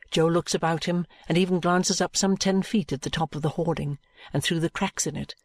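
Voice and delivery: plain reading voice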